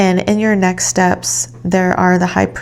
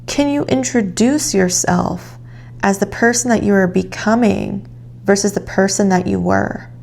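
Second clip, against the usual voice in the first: normal talking voice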